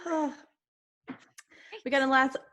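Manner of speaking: ordinary delivery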